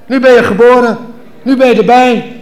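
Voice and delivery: doctor's voice. Acted. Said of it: "siste hij".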